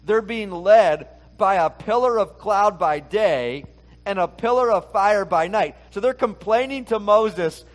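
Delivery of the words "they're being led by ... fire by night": ordinary delivery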